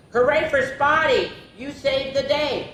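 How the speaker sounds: high, jubilant hooray; excited storybook celebration; crowd cheer. A narrator's reading. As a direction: cheer for the hero. Animal crowd cheers the hero.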